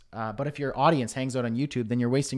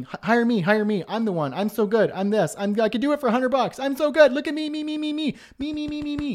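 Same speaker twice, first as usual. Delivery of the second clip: high, loud, frantic begging for attention; hammed-up desperate pleading, climbing higher and higher; a crowd of needy people clamouring to be picked; mock panic; 10 semitones higher, 5 dB louder than usual. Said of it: "a whole bunch of people panicking, going".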